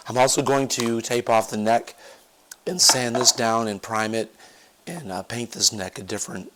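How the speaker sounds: ordinary talk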